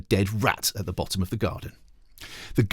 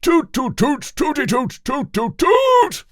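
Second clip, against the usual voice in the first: high, loud toot toot fanfare; mock trumpet; 6 dB louder, slower than usual. Royal trumpeter tooting an imitation fanfare.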